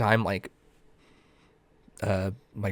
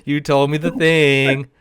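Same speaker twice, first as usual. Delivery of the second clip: slow, high, laughing through it; giggling slow mock accusation; laughing punchline; 4 semitones higher, 7 dB louder, much slower than usual. Real, not acted. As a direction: drag it out while cracking up.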